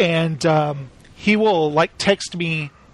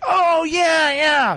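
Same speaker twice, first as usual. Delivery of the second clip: high, eager mock shout; Jesse James; 9 semitones higher, much slower than usual. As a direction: accept the dare with a whoop. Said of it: "he was like".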